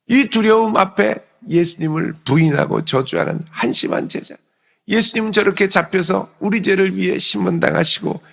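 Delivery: high, loud self-reproach; acted anguished lament. Peter berating himself after denying Jesus, anguished lament. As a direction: berate yourself in rising despair, without sobbing. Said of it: "이러면서 통곡하며 울었을 겁니다".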